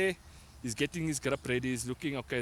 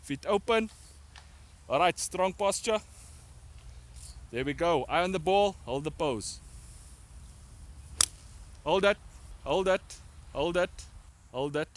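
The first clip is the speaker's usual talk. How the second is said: loud barked setup commands; drill sergeant; 4 semitones higher, 3 dB louder than usual. Real, not acted. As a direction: bark each step out. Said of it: "that drill sergeant now".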